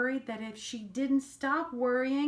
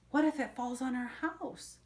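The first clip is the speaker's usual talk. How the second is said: plain reading voice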